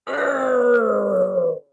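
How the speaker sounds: long pirate arr from the mouth's corner. Shown as a demonstration. Teacher showing group Talk Like a Pirate, pirate growl. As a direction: draw it out like a pirate. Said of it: "out of the corner of your mouth".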